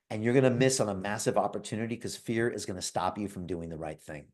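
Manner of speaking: ordinary delivery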